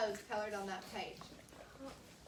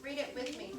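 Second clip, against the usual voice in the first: ordinary delivery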